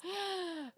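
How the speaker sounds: soft, high-pitched gasp; mock gasp. Moving on to poor Bailey's news, mock gasp. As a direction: draw in a quick mock gasp. Mock shock before next story beat.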